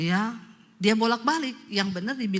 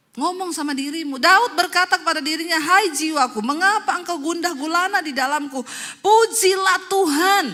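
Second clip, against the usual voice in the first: loud, raised exhortation; full-force preaching; 9 semitones higher, 6 dB louder than usual. Acted. Preacher tells congregation to address their souls, forceful preaching. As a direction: command your own soul loudly.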